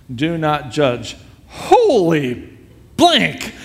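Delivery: slow, deliberate reading bursting into a drawn-out exclamation; imitated astonished reading. Rock star reading the Bible aloud, then stunned. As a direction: read it solemnly, then blurt in amazement. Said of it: "he reads it like this".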